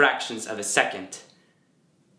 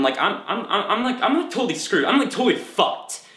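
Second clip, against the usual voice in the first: higher-pitched, stammering distress; 9 semitones higher than usual. Acted. Athlete admitting everything he believed is a lie, distressed. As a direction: stumble upward into acted exasperation. Acted monologue, despairing at his limit.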